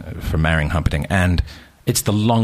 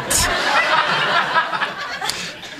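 high wheezing laugh. Real, not acted. Laughs at nurse.

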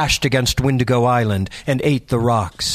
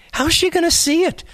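put-on much higher boy voice; curious teen boy. A narrator's reading. Second boy doubting the message, high boy voice. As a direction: ask it like a skeptical kid.

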